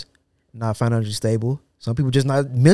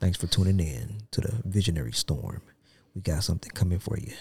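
softer, lower, smooth radio host sign-off; late night radio voice; 4 semitones lower, 6 dB quieter than usual. Pretend. Teasing his cohost by showing his late night radio voice, soft and low. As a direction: croon it like a late-night DJ for the ladies. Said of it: "now that's my late night voice".

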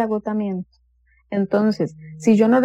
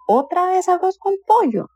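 much higher, whining "Otra vez"; imitated whiny complaint; 9 semitones higher than usual. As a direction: mimic someone moaning at the dinner table. Imitating complaint about lunch.

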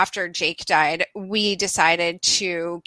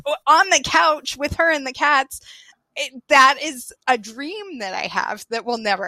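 higher, incredulous echo of useless advice; retold incredulity; 6 semitones higher than usual. Dog owner answering a rescue's useless advice, incredulous. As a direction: throw the suggestion back in mock disbelief.

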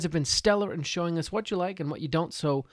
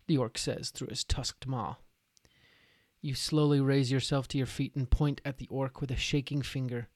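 dramatic narration; 2 semitones lower than usual. A narrator's reading. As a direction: set the grim battle scene. Game master's scene setting.

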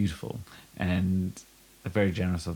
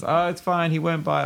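calling from upstairs; 8 semitones higher than usual. Retold or imitated. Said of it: "a voice call from upstairs".

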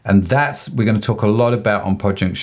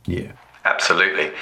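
normal talking voice

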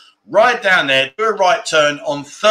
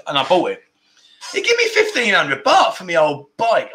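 ordinary delivery

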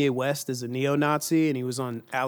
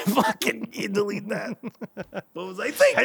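laughing, higher voice; laughing embarrassed; 6 semitones higher than usual. Real, not acted. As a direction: laugh it off, mortified. Embarrassed over joke.

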